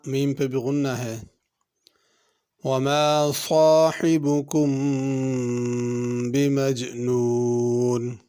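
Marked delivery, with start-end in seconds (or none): 2.6-8.2 slow, drawn-out chanted recitation; measured melodic tilawat; Quran recitation. Shown as a demonstration. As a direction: recite it like the teacher modelling tajweed. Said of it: "teacher recites verse to model tajweed".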